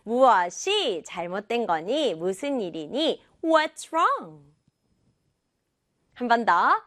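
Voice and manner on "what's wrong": "What's" and "wrong" each punched, pitch raised; both words stressed. Shown as a demonstration. Teacher modelling the third sentence, both words stressed. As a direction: give "What's" and "wrong" equal beats, then fall. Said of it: "이건 둘 다 해야 돼요".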